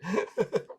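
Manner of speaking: high, light giggle; short chuckle trailing the story; amused laugh. Real, not acted. Laughing after own exclamation.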